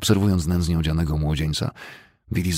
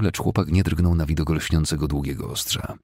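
ordinary delivery, nothing marked

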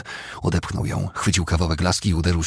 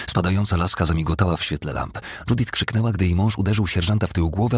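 usual speaking voice

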